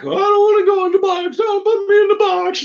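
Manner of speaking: very high, loud pleading puppet voice; the ball begging not to be boxed; protesting puppet. Acted. Puppet protests being put away.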